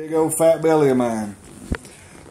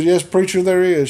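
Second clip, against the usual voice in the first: higher-pitched mock mama voice; imitated mother; 7 semitones higher than usual. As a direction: put on your mother's higher voice. Mother answers preacher in story.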